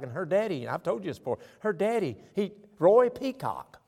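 ordinary delivery

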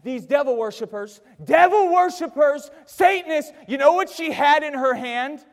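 loud, high, building indignation from the pulpit; full-force preaching. Real, not acted. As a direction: thunder it out to the congregation.

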